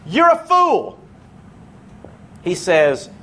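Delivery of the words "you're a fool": high-pitched blunt condemnation; mock accusation, high then falling; harsh verdict. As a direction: act out a graceless put-down, pitched way up. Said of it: "He doesn't say".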